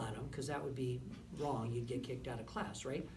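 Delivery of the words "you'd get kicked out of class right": ordinary delivery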